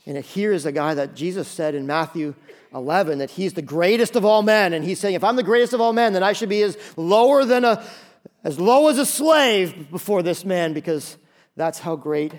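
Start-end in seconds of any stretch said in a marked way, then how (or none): 2.7-12.3 raised, louder preaching voice; impassioned preaching. Acted. Preacher arguing John's humility to the congregation, impassioned. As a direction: drive the point home with mounting force.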